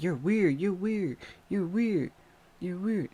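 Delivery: weirded-out cousins; freaked-out repeat; disgusted repeat. Retold or imitated. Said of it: "they like".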